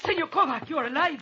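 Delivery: high, astonished exclamation; shocked. Acted. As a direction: react as if seeing a ghost. Dead man reappears.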